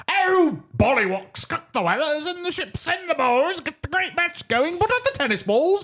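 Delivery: very high, babbling cartoon Brit; Montgomery caricature. Montgomery babbling about the great match, absurd high caricature. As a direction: run on in a hammed-up high British voice. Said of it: "him being like".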